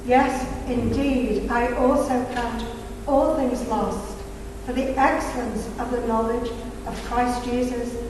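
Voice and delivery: normal talking voice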